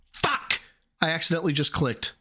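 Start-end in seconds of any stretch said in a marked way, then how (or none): 0.1-0.6 sudden high-pitched swear; frustrated curse. Real, not acted. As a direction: swear in real exasperation, pitched up. Curses after a mistaken click.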